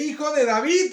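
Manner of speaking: loud, high-pitched call; acted clamour; crying out. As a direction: call out insistently to be heard. Voicing the woman's cry.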